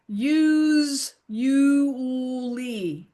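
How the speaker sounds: "use, you, lee" stretched out in separate slow syllables. Shown as a demonstration. Tutor showing a learner how usually sounds, syllable by syllable. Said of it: "But then you say, "It sounds like".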